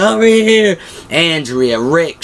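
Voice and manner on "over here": higher, raised calling voice; acted calling out. Daryl summons everyone after killing the zombie, acted calling out. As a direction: imitate calling someone from afar.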